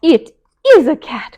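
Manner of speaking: high, loud alarmed cry; frightened cry. A narrator's reading. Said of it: "cried Melissa".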